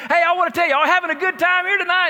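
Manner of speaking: retold preacher hollering to a big crowd; loud preaching. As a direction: fire up the crowd like a revival preacher. Phil preaching at Ducks Unlimited.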